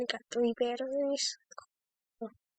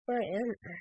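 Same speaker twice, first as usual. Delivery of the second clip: normal talking voice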